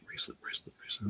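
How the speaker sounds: whispered search through notes; whispering. Real, not acted. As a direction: whisper to yourself while looking. Searching her listing.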